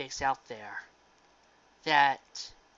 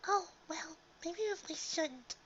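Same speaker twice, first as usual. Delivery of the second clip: high, soft, timid pony voice; Fluttershy voice; 12 semitones higher, 7 dB quieter than usual. Retold or imitated. Fan imitating Fluttershy's hesitant worry, high and soft. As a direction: squeak it out shyly, hesitating like a gentle pony. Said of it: "the one who goes".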